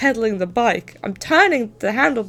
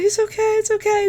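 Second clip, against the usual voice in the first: much higher mock soothing dad; comforting dad; 12 semitones higher than usual. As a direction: hush the hurt child. Dad comforting bleeding kid.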